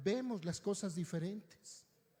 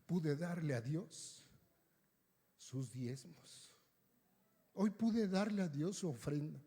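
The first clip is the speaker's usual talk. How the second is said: a mild hint of crying while quoting her; tearful retelling; 3 semitones lower, slower than usual. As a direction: let the words catch as if near tears. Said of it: "llorando me dice".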